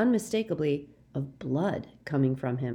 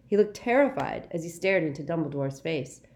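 plain reading voice

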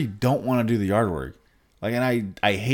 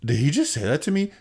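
mock shocked; 4 semitones higher than usual. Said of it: "Her little Pikachu face".

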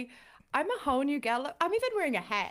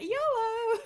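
high-pitched, playful yolo cry; carefree whoop; 12 semitones higher than usual. Acted. Friend shrugging off blowing through money, carefree whoop. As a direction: throw it out high and reckless.

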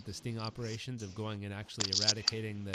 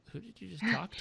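curious wife; 11 semitones higher, 5 dB louder than usual. Retold or imitated.

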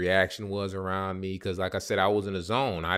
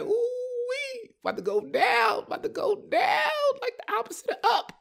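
squealed falsetto hype; hammed-up high-pitched glee; giddy excitement. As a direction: shriek it sky-high with anticipation. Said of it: "I got that, that excitement".

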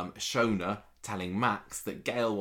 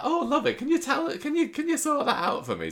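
put-on old lady voice; 5 dB louder than usual. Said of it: "she's like".

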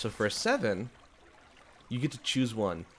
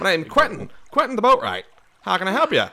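jolly boatwright; 10 semitones higher, 8 dB louder than usual. Acted. NPC boatwright introduces himself.

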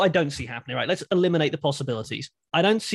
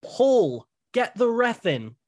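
mock shout; 7 semitones higher, slower than usual. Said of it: "Roman Reigns shouts to Heyman".

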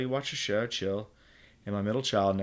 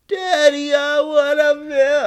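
put-on very high, loud crying child voice, slow; crying whiny kid; 13 dB louder, slower than usual. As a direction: bawl it out like a sobbing toddler.

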